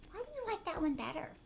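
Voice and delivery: ordinary talk, nothing marked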